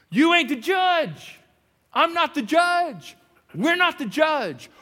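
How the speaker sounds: slow, high, loud, every word weighed; drawn-out emphatic pronouncement; emphatic declaration. Acted. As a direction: hammer each line slowly and loudly.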